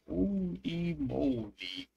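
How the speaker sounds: usual speaking voice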